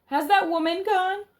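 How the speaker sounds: high loud cry of Grandmama; shouted call. Acted. Frightened boy shouting down to his grandmother, high loud call. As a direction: yell Grandmama up high to reach below. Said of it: "I shouted".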